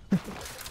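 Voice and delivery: unvoiced wheezy giggle; breathy laugh. Real, not acted. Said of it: "I like the laugh".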